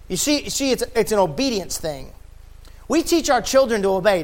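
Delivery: ordinary delivery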